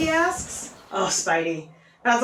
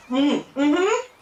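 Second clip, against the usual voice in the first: acted muffled, food-stuffed Spidey; mouth full; 3 semitones higher than usual. A narrator's reading. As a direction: pretend talking through a mouthful. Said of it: "he talks with his mouth full".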